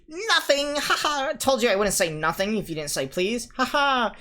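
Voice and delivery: loud high mocking laugh; gloating cackle. A narrator's reading. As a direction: crow with glee at the trick. Peeves gloating trick.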